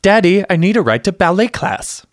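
put-on high, childish whine; mock a bratty little girl asking Daddy; high little-girl whine for a ride; whiny little sister. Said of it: "she'll be like".

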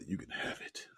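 soft breathy whisper; whispered sultry. Retold or imitated. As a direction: whisper it seductively. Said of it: "she was like".

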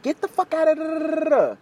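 high-pitched imitation of her angry friend; mock telling-off. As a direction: scold shrinking into nonsense syllables. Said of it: "like that".

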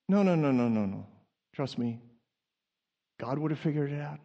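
low, soft soothing; calm reassurance. Acted. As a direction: talk someone down from a panic, softly. Calming the mock panic.

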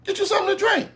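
high-pitched imitation of a girlfriend. Girlfriend pressing her man to order, imitated in high voice. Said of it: "She like".

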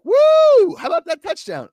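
high, loud mock cheer; gloating whoop. Friend texting a gloating cheer, loud and high. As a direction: rub it in with a whoop. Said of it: "he starts texting me like".

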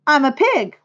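put-on high, loud boyish shout; crying out. A narrator's reading. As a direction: play a boy proudly calling out. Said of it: "cried Avery".

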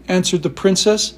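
ordinary delivery

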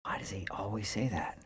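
very soft, half-whispered; hushed inner thought. Retold or imitated. Dishwasher recalling a private thought, hushed. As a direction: think it aloud under your breath.

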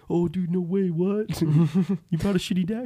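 high, loud mock disbelief; incredulous friend voice. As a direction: play a stunned buddy asking. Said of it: "they'd do the typical".

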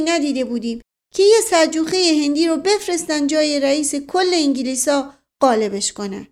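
high, loud folksy outrage; excitable servant swearing to it; indignant loyal servant. Acted. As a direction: pile on with eager indignation.

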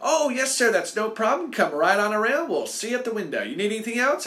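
drive-thru worker over the mic, sweetly high; overly nice worker. Retold or imitated. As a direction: gush like a too-friendly drive-thru worker. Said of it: "was being super nice to me".

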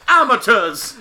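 loud, high operatic diva outburst; hammed-up prima donna outrage; Carlotta. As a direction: fling it out like an offended diva. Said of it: "Literally pulls a full Carlotta".

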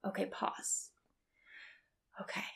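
whispered okay pause; soft whispered self-command; whispered self-check. Retold or imitated. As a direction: whisper it to stop yourself. Calming herself in retold moment.

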